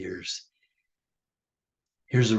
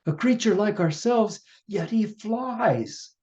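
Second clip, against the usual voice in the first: much higher, loud amazed cry; 10 semitones higher, 4 dB louder than usual. Acted. Downstream creatures cry out in amazement. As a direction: shout the wonder of a miracle.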